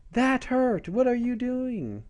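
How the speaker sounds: high-pitched, drawn-out mock outrage; indignant girl's protest. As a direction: complain shrilly as if just scratched, hammed-up. Scratched girl protests to the cat.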